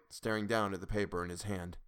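ordinary delivery, nothing marked

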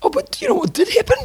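squeaky high teasing question; excited high. Real, not acted. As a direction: jump way up in pitch, teasing. Teasing build-up, voice jumps up.

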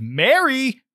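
a sudden inner alarm on one high word; mild scream. Acted. Joey's inner voice crying out her name, mild high scream. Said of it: "a tiny voice in the back of his head screamed".

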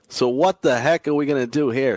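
ordinary delivery

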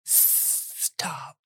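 hissed, breathy snake voice; whispered Parseltongue hiss. Acted. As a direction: hiss it like a serpent. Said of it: "Tom says to him in Parseltongue".

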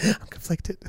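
high, hammed-up nervous quaver; mock quivering voice. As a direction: play a trembling dater's voice note. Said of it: "the quiver in their voice".

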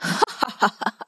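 mock witch laugh, soft and odd; a short eerie chuckle; witch's laugh. A narrator's reading. Witch laughing at Elsie's demand, eerie chuckle. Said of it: "The witch laughed".

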